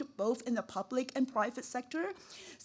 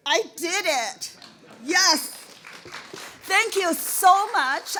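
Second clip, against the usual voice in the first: triumphant joy; 8 semitones higher, 8 dB louder, slower than usual. Real, not acted. Triumphant award acceptance.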